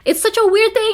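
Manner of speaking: high, loud exclamation of amusement; telling viewers about the English greeting, exclaiming high and loud. Real, not acted. Exclaiming at odd custom.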